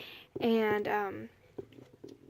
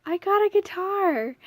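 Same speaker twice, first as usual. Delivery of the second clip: high, loud burst of joy; thrilled exclamation; 9 semitones higher, 7 dB louder than usual. Retold or imitated. Re-living the moment of seeing the guitar, a thrilled cry. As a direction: blurt it out overjoyed. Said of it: "I'm like".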